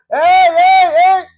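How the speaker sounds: high, loud calling out; shouting hey. Retold or imitated. Micaía's neighbours shouting after the Danites, loud call. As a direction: yell after them to stop. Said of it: "les empiezan a gritar".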